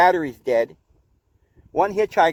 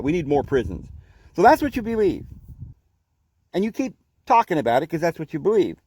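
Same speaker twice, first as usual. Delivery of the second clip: ordinary talk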